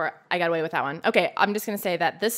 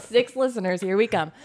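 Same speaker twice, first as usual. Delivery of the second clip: ordinary talk, nothing marked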